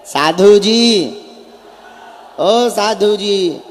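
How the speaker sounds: long, drawn-out call; crowd call. Real, not acted. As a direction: stretch it out to the whole crowd. Calling out to the crowd.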